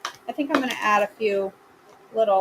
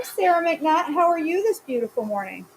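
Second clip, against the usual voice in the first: ordinary delivery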